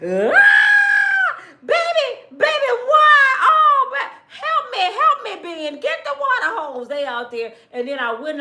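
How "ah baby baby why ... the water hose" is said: high-pitched panicked shriek, loud. Retold or imitated. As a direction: squeal in a high voice, begging for help. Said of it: "He screamed like [name]".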